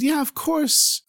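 high, soft, slow wife. Acted. Wife voiced in the story.

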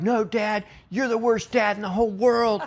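child wailing at dad from the top of the climbing frame, mock-whining; whiny kid. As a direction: play the upset kid on the jungle gym, a touch of whine. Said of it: "they're like".

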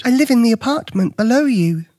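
put-on high, timid boy's voice; nervous young Luke. A narrator's reading. Luke explains himself to the stranger, nervously.

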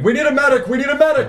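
high, urgent repeated call; shouting for help. Acted.

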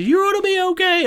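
very high-pitched mother impersonation; mock soothing mom. Friend's mom to crying boy, imitated high-pitched comforting.

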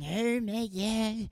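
put-on high-pitched old-timer voice; tentative old person impression. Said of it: "an impersonation of an old person saying".

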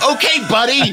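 high, chirpy fake encouragement; mock-friendly. Sending off an angry driver with a thumbs up, mock-friendly. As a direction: needle him with sugary cheer.

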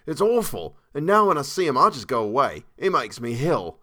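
revolted Cockney servant; Cockney dialect. A narrator's reading. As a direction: finish the confession in Cockney, dragging the last words. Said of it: "Thomas's Cockney dialect".

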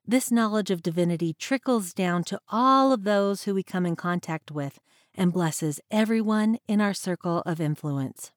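ordinary talk, nothing marked

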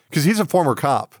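usual speaking voice

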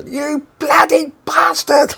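very high, slow, strained mock yell; retold angry shout. Coleslaw-covered man shouting up at Lol, mock outraged yell. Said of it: "The guy shouted up".

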